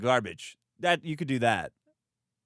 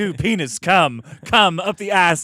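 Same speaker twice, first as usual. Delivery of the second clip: loud hammed-up camp moans; campy British parody moans; camp mock moaning; 4 semitones higher, 9 dB louder than usual. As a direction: overdo the camp moaning for laughs. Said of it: "mock camp voice".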